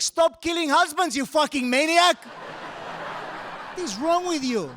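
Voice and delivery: high, loud mock yell; hammed-up horrified outburst; mock outraged yell. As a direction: shout it at her like she's a killer. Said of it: "I was like".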